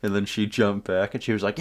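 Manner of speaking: plain reading voice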